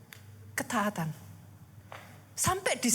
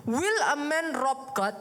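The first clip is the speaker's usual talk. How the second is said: slow reading; much slower than usual. Shown as a demonstration. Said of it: "Dikatakan dalam bahasa Inggrisnya".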